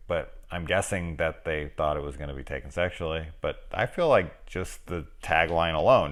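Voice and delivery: ordinary talk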